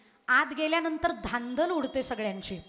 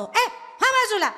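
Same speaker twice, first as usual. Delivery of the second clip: high, loud mock shout; imitating a temple guard yelling; guard's shout; 7 semitones higher, 7 dB louder, slower than usual. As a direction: bark at the crowd to move aside. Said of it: "security guard जोरात ओरडतो".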